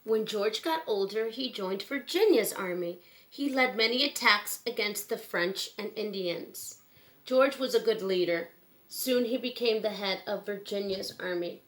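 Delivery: clear, gentle storybook read-aloud; reading to children. Acted. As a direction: read it like bedtime story time for kids. Reads picture book to children.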